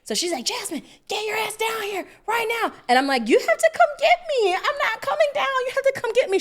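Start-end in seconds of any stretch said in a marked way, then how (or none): 0.4-2.7 high, strained yell without much volume; held-back mock scream; retold mom's scream. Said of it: "screaming at me". 3.3-6.4 put-on very high, stubborn kid voice; acted-out kid calling down to mom; mock child's yell. Child refusing, calls to mom.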